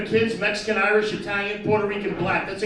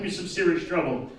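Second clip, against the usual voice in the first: ordinary talk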